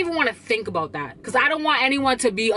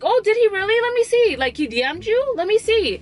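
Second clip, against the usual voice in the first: mock surprise; 8 semitones higher than usual. To the friend who claims [name] DM'd her, mock surprise. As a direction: call her bluff with exaggerated curiosity. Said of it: "So I'm like".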